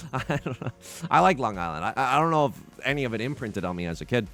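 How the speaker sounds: usual speaking voice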